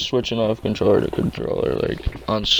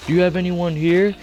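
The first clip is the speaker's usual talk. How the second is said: stepdad asking; 7 semitones higher than usual. Retold or imitated. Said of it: "He's like".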